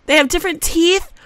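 high, loud, rising at TEETH; surprised discovery. Real, not acted. Gamer discovering toddler teeth options, loud surprise. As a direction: blurt it out, lifting on "teeth".

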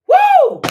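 high, loud whoop of joy; joyful whoop. Real, not acted. Whooping to her viewers in celebration. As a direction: let out a big happy whoop.

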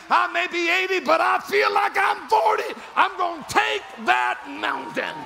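high-pitched rousing shout; triumphant declaration. Acted. Caleb at eighty claiming his mountain, triumphant shout. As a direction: declare it at full preaching pitch.

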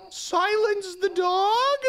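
loud, very high, drawn-out question; slow, incredulous wail; appalled disbelief. Real, not acted. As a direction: stretch it out in high-pitched horror. Appalled by the game's order.

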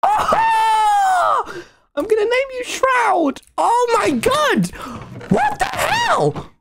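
high, squealing delight; excited squeal. Real, not acted. Naming his pet spider Shroud in high squealing delight. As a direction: gush over the new pet.

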